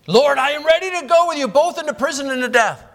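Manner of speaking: high and loud, bold eager pledge; eager vow. Retold or imitated. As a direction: Peter swearing he's ready, full of zeal. Peter vows to follow Jesus.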